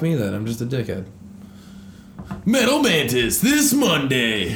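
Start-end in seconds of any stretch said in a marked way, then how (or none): 2.4-4.6 monster truck ad. Pretend. Continues the truck ad gag.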